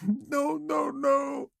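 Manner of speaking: high, broken sobbing denial. A narrator's reading. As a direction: cry out against the truth. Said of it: "Tesina sobbed".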